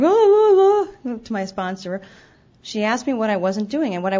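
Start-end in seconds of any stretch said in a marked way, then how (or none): 0.0-1.2 high, loud mock sobbing wail; imitated sobbing. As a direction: replay the tearful moans to the sponsor, much higher and louder. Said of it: "when I was in tears going".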